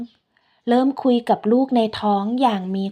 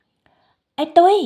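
frightened call; 7 semitones higher, 4 dB louder, much slower than usual. Acted.

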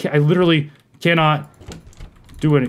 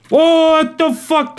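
startled; 12 semitones higher, 4 dB louder, much slower than usual. Real, not acted. Sees monster.

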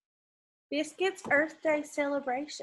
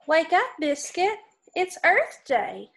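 lively storybook read-aloud; reading to children; 3 semitones higher than usual. Acted. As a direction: read it brightly for little kids. Reading picture book to kids.

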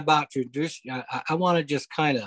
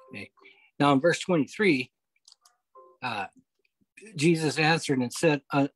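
normal talking voice

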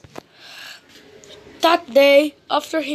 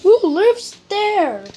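higher, drawn-out curious question; puzzled storybook query; wondering; 6 semitones higher, 3 dB louder than usual. A narrator's reading. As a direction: wonder aloud, slowly and high. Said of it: "Little Miss Brilliant wondered".

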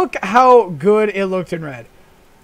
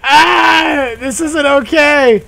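raised, drawn-out yell; yelled alarm; 4 semitones higher, much slower than usual. Real, not acted. Player going down in the car, yelling in alarm. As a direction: cry out as the ride goes wrong.

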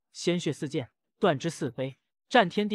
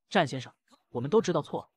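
usual speaking voice

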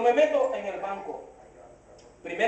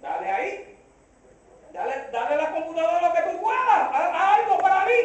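high, quick pleading; mock urging; 5 semitones higher than usual. Customer pushing the banker to work the computer, eagerly urging. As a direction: egg the banker on, pitch climbing.

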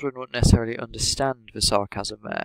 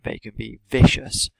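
vicious punched hard and louder; vicious said with heavy emphasis; stress on vicious; 2 semitones higher, 6 dB louder than usual. Shown as a demonstration. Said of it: "She really emphasizes that word".

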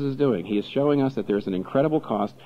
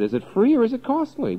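usual speaking voice

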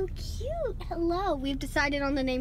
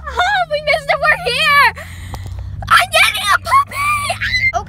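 high, loud, teary squeals of joy; can't contain herself at the puppy place; excited shrieking; 12 semitones higher, 10 dB louder, slower than usual. Real, not acted. Pulling up to the puppy place with family, shrieking with joy.

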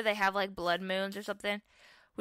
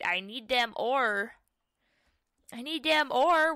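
usual speaking voice

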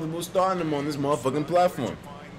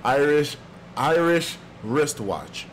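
slow, deliberate tongue-twister warm-up; measured practice run at "Irish wristwatch"; slow and careful; much slower than usual. Shown as a demonstration. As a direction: sound out "Irish wristwatch" slowly, word by word. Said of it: "three times without messing up".

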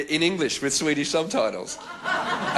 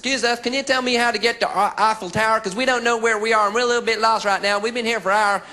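high-pitched lost American; mock American asking directions; American tourist; 8 semitones higher, 3 dB louder than usual. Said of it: "approached by an American on the street today".

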